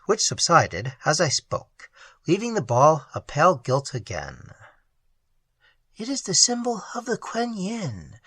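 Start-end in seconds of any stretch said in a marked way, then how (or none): 5.9-8.2 soft, higher, trembling. A narrator's reading. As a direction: say it with a shaking voice. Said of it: "she said in a trembling voice".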